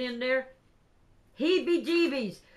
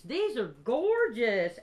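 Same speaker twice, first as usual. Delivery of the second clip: ordinary talk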